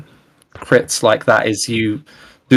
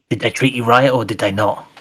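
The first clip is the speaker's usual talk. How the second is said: lightly voiced goblin asking its friend; a hint of a worried goblin.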